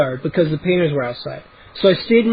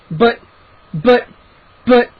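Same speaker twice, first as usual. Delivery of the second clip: sputtering; 5 semitones higher, 4 dB louder, slower than usual. A narrator's reading. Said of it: "I sputtered".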